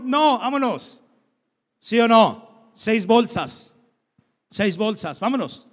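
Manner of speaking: impatient mother snapping at her son to hurry; bossy mother. Retold or imitated. Mother hurrying son through clutter.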